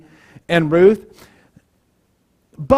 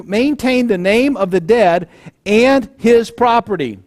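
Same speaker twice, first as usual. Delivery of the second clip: usual speaking voice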